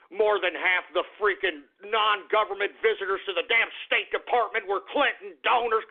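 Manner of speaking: normal talking voice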